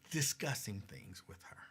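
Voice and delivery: a sly whispered correction. Real, not acted. Whispering a cheeky correction to the viewers. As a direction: breathe it out as a mischievous aside.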